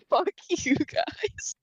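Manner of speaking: high, slow, laughed-out taunt; playful jeer breaking into laughter; laughing mock taunt. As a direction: say it through a laugh as a mock brush-off. Mock quote of rude character.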